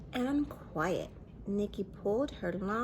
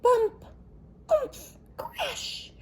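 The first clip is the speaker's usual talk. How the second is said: crash sound effects; 8 dB louder, much slower than usual. Acted.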